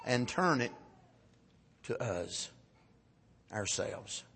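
low, soft, halting close; grave warning. Real, not acted. Preacher turns God's wrath onto the congregation, hushed grave warning. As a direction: let it sink away with pauses.

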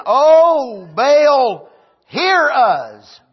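loud, high wailing cry to Baal; prophets of Baal crying out. Acted. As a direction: cry out to a false god, begging.

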